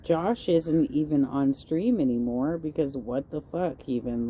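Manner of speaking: ordinary delivery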